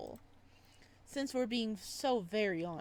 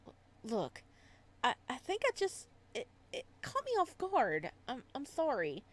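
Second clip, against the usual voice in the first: stumbling, pitched up, quick; nervous apology; flustered stammer; 2 semitones higher than usual. Acted. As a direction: caught off guard and tripping over words. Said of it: "get snippy with you".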